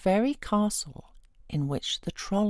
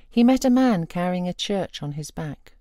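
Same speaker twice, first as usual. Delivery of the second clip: usual speaking voice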